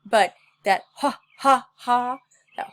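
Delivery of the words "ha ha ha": loud pushed "ha" bursts; forceful diaphragm exercise; hard ha. Shown as a demonstration. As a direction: punch each ha from the belly. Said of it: "really hard".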